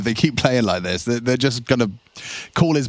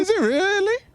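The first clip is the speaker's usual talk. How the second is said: exaggerated squeaky falsetto crack; voice breaking on purpose. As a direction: ham up the voice crack. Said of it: "your voice is breaking".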